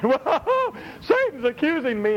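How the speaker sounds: high, laughing startled yelp; mock panic. Preacher mocks a believer panicking at Satan's accusation, yelping high. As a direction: play a believer suddenly spooked. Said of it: "panicked believer quoted".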